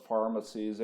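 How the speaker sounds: normal talking voice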